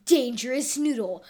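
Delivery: ordinary delivery, nothing marked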